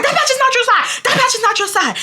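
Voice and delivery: very high, squealed mock yell; shrieky, insistent comeback; retold screaming. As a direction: screech it like a neighbor argument. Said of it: "we're screaming at each other".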